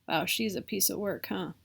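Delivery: usual speaking voice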